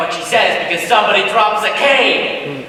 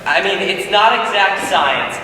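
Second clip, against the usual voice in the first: ordinary talk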